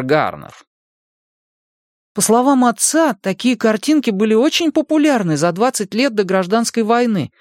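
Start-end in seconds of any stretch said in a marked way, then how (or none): 2.1-7.3 put-on much higher-pitched boy's voice; eager boy Mike. A narrator's reading. Mike shows his friends an old picture, high boyish explaining. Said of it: "объяснял Майк".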